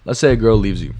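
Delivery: normal talking voice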